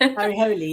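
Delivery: "Holy" as HOH-lee; correct pronunciation. Shown as a demonstration. Author echoing the host's correction, "Holy" in two syllables. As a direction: repeat "Holy" with the final ee. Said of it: "holy is the correct pronunciation".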